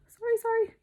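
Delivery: high squeaky apology; a quick high-pitched sorry. Real, not acted. Teacher apologizing to class in a squeak.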